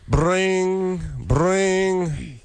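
high, sustained voiced rings; imitated phone ring. Host restarting the phone skit, mimicked rings. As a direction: make the sound of a ringing phone.

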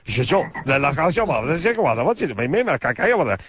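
imitating an angry dad rambling in fake French; mock French. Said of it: "he'd lean on and go".

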